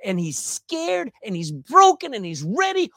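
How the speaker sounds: usual speaking voice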